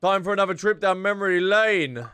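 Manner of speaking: ordinary talk, nothing marked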